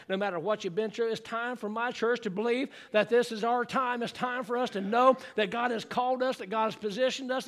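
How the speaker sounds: ordinary delivery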